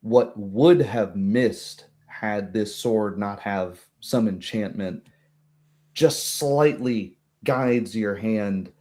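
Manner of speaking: ordinary talk, nothing marked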